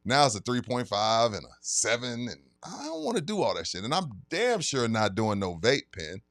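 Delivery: usual speaking voice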